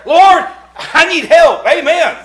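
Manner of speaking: high, loud, slow plea; quick desperate prayer. Retold or imitated. Preacher acting out his own urgent prayer, high and loud. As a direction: cry out to the Lord. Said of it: "micro espresso prayers".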